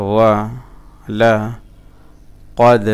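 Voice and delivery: ওয়া, লা, কদ with a full pause after each; broken-up syllables. Shown as a demonstration. Teacher showing learners the parts, halting syllable by syllable. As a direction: recite ওয়া লা কদ piece by piece. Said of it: "আমি ভেঙে ভেঙে বলতেছি".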